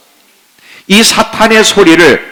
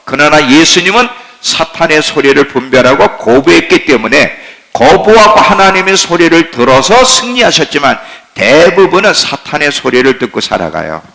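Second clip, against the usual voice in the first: ordinary delivery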